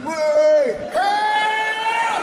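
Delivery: high, loud panicked cry for help; shrieking alarm at a dog; mock panicked shout. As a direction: yell for help like a scandalised mum. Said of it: "I've never done".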